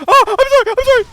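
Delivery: gasping, high and loud sorry; startled apology. Real, not acted. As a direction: blurt out a shocked apology. Startled apology, gasp first.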